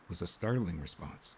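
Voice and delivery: ordinary delivery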